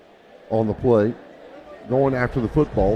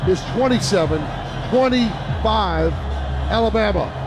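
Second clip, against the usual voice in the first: fast, raised radio play-by-play; live play-by-play; 5 semitones higher than usual. Real, not acted. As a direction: call the play as it happens.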